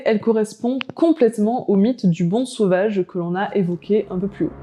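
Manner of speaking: ordinary talk, nothing marked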